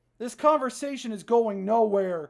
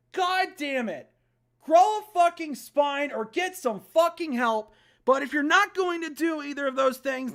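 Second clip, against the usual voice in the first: loud, high, slow, hammered words; furious yelling; 4 semitones higher, 6 dB louder than usual. Real, not acted. Player yelling at the whining character to toughen up. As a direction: yell it at him.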